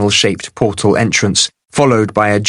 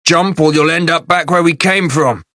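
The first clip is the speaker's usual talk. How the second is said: urgent yell; 7 semitones higher than usual. A narrator's reading. Warning yell to the prisoners.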